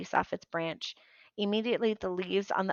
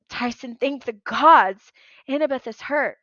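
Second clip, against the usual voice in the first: relieved urgent call; 5 semitones higher, 10 dB louder than usual. Acted.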